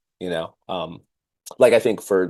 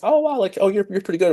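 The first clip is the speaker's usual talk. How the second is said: high gushing compliment; 11 semitones higher, faster than usual. Retold or imitated. Quoting a compliment.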